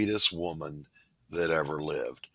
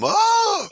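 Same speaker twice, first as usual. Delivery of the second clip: much higher, louder wailing cry; mock child squall; 6 dB louder than usual. Host imitates his kindergarten self squalling, high wail. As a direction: wail like a little kid getting whooped. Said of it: "I squalled".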